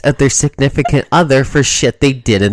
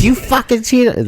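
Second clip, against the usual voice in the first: mock accusation; 9 semitones higher, slower than usual. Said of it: "she's like".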